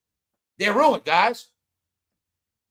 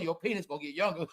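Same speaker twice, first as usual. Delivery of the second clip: laughing; 4 dB quieter, faster than usual. Real, not acted. Laughing at own joke.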